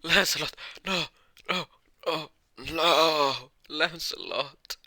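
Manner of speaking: high, put-on wailing and pleading; anguished scream. Merlin finds Lancelot dead, screaming in pain. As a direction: cry out high, loud at first, then pleading. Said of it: "Merlin would start to scream in pain".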